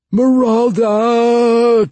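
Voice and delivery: long, loud, high falling howl; desperate wailing howl. A narrator's reading. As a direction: howl it as you fall. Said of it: "Jacka's last cry, a desperate, wailing howl".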